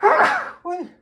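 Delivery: a loud sudden sneeze; sneezing. Real, not acted. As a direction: sneeze out hard, then a little oi. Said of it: "I'm going to sneeze".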